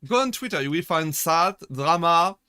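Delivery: ordinary delivery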